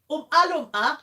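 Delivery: high, loud mock Geordie; Geordie accent. Host attempts Geordie on request, loud Geordie accent. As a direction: belt out a silly Geordie phrase. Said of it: "Do Geordie".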